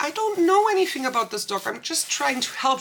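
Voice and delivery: high, rushed self-defence; agitated protest. Retold or imitated. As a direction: protest fast, pitch jumping right up. Retold self-defence to yelling woman.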